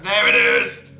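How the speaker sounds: very loud burst of recognition; sudden yell. Real, not acted. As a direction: yell it out abruptly. Interrupts reading with a yell.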